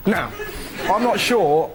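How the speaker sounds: ordinary talk, nothing marked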